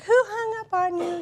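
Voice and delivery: imitating his high, loud, drawn-out outrage; angry husband. Wife retells husband's reaction to being hung up on, mock angry. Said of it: "He was angry".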